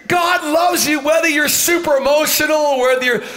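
high-pitched, forceful pulpit proclamation; impassioned preaching. Real, not acted. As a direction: build to a shouted assurance.

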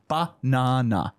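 "Banana" slow and low, split in three; syllable by syllable. Shown as a demonstration. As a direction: say "Ba-na-na" as three even beats. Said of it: "Three syllables".

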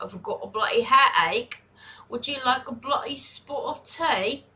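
playful mock-British accent; British accent impression, lilting up at the end. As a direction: put on a hammy British accent. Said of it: "I love the accent".

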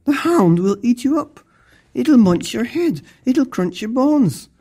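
high-pitched, hammed-up dire warning; panicky warning. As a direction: pile up the dangers in high alarm. Terrified family warns child of dog.

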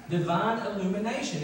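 ordinary talk, nothing marked

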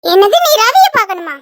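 loud, indignant disbelief; mother scoffs loudly at the visitor; outraged. Acted. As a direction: throw the question back angrily.

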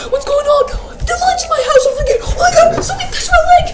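high, loud panicked shriek, rushing; terrified scream. Real, not acted. Gamer shrieking to viewers after something touches his leg. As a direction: scream like something grabbed you.

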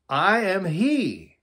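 high, loud and drawn-out proclamation; bold declaration. Acted. Jesus declares himself to the arresting soldiers, bold declaration.

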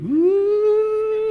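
high, sustained eerie wail; creepy "ooh". Retold or imitated. Preacher imitating the movie's creepy green smoke, high eerie ooh. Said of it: "It's really kinda creepy".

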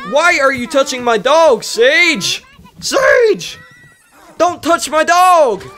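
high-pitched indignant calling out; playfully furious, high and indignant; mock outrage. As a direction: scold someone in mock outrage, pitch climbing. Scolding sim for touching dog.

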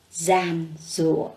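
"gian rùa" said slowly and carefully, each syllable clear; slow model reading. Shown as a demonstration. Teacher models the word for her class, slow and careful. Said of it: "Cô mời các em đọc lại từ này".

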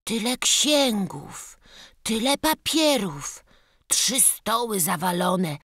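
put-on a small village boy gaping at piles of books; young boy marvelling.

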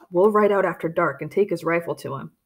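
plain reading voice

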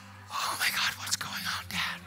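breathy, voiceless alarm; acted frightened whisper. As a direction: whisper it as a scared daughter to Dad. Daughter frightened, asks dad.

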